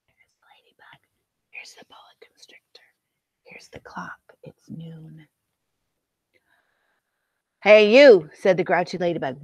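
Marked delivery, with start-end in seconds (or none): 0.1-1.0 put-on hushed whisper to the children. 1.5-5.3 soft whispered aside; whispering. Acted. 7.6-8.3 grouchy shout. Acted. Grouchy ladybug picks a fight.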